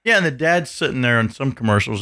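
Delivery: ordinary talk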